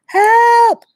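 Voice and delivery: high, loud cry for help; calling for help. A narrator's reading. Boy trapped in a hole calling out, loud and high. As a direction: yell up out of the hole. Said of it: "He began to call out".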